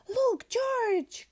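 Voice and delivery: Peppa shouting with delight; little pig pointing out puddles, high and thrilled; excited cry. Acted. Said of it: "cries Peppa".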